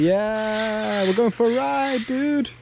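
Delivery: put-on high, goofy baby animal voice; sloth voice. Voicing the sloth.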